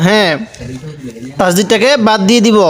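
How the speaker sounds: normal talking voice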